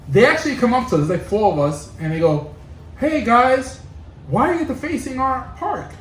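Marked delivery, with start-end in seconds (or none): 3.0-5.9 put-on higher, polite kid voice; really nice. Chipper kids asking the graffiti writers, imitated very nicely. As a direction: ask it nicely, pitched up. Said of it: "Really nice".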